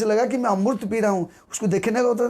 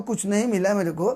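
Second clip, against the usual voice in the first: normal talking voice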